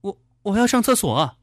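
high, stammered protest; retorting. A narrator's reading. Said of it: "少丽反驳他".

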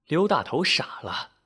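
usual speaking voice

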